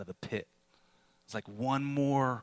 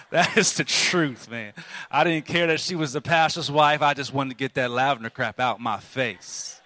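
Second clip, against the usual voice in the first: laughing; 3 semitones higher, 7 dB louder than usual. Real, not acted. Laughing confession to the congregation.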